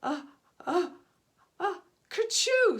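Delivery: high halting uh uh building to a loud kerchoo; building sneeze. A narrator's reading. Chief sneezing at the angry crowd, loud burst. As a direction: wind up and explode into a sneeze. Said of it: "sneezed the chief".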